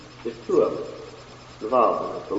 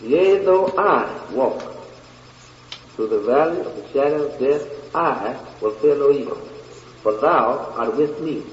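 stretched words, opening raised in pitch; slower scripture reading. Shown as a demonstration. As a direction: draw the verse out slowly.

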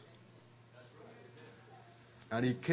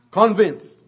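a single shouted, higher word; loud exclaim; 4 semitones higher, 14 dB louder than usual. Real, not acted. Preacher crying out to the congregation, loud. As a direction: shout it out with full force.

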